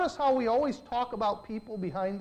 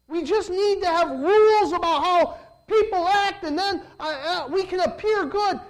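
loud, high preaching voice; full-force preaching; 6 semitones higher, 7 dB louder than usual. Real, not acted. Pastor warning the congregation about rules, loud preaching. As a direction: drive the point home to the pews.

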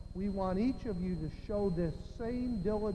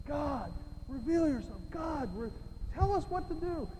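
higher-pitched, pleading prayer; earnest prayer; 6 semitones higher than usual. Retold or imitated. Preacher retelling his prayer group pleading with God, earnest. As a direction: re-enact a group praying earnestly for an answer. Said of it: "we were praying so earnestly".

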